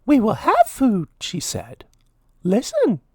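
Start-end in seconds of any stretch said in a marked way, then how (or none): 0.1-1.1 high, louder mouse voice pleading; Minnie pleading. A narrator's reading. Minnie begging Lord Gurr, high pleading mouse voice. 2.4-3.0 Minnie pleading. A narrator's reading. Minnie begging Lord Gurr, high pleading mouse voice.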